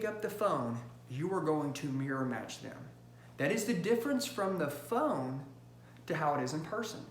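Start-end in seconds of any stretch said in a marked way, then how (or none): none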